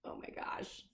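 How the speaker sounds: breathless hushed gasp of awe; hushed oh my gosh; whispered wonder. Real, not acted. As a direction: whisper it like you're amazed. Reader reacts to monster picture.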